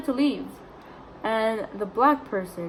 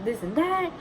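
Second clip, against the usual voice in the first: mocking mimicry; 5 semitones higher, 4 dB louder than usual. Mimicking the stranger threatening mom with police, mocking. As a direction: mimic a complainer threatening to call someone.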